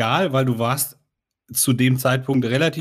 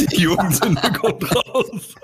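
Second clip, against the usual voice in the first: laughing; 7 semitones higher, slower than usual. Real, not acted. Teasing about the youth sin.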